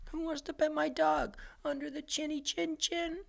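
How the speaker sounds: very high, soft, sing-song owner voice; high cooing mock owner; proud dog owner. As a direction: coo like a smug owner showing off the dog.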